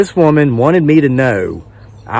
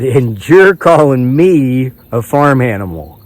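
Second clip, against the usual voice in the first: louder, slow, emphatic disbelief; incredulous comeback; 5 dB louder, slower than usual. Retold or imitated. Man to the woman after showing his wife, retold incredulity. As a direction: throw the insult back, drawing out each word.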